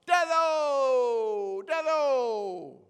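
high, drawn-out mock kids' holler; imitated children's shout. Grandchildren greeting their grandfather from another room, shouted. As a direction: shout it like excited little grandchildren, stretched long and falling. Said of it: "five-year-old and two-year-old shouted out".

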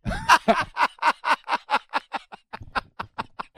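high-pitched burst of laughter; real laughter rising high. Real, not acted. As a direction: crack up at your own joke. Laughing at own cheeky answer.

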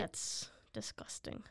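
disgusted whisper. Real, not acted. Grossed out by raw potato.

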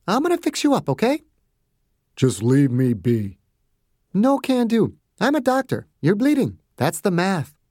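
no-nonsense bedside manner; doctor insisting on treating a patient; brisk Margaret. A narrator's reading.

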